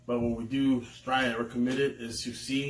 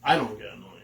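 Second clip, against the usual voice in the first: ordinary talk, nothing marked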